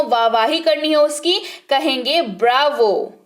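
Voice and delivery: normal talking voice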